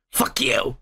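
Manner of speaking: breathy whispered Fuck you, soft; whispered curse. Real, not acted. Host cursing the award organizers under his breath, whispered. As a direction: hiss it under your breath.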